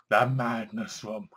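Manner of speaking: slow, soft, eerie intonation of the title; spooky title voice. Shown as a demonstration. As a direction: savour the title like a horror host. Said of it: "Ah, madness".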